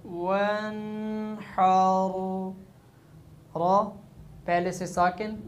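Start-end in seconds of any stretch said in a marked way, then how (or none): 0.0-2.1 वन हार stretched; slow recitation. Shown as a demonstration. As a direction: chant वन हार long. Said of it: "हा रा ज़बर हार".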